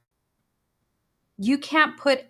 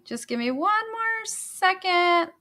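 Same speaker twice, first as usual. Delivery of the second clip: high, drawn-out singsong; singsong plea for patience; playful stalling; 7 semitones higher than usual. Real, not acted. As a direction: stretch it out slowly and high while searching. Stalling while searching for the title.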